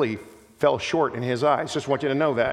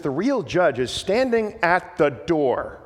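slow and weighty, every word of "The real judge is standing at the door" leaned on; emphatic; slower than usual. Retold or imitated. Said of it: "he says that emphatically".

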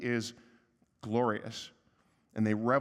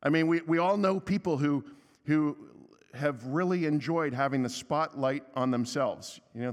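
ordinary delivery

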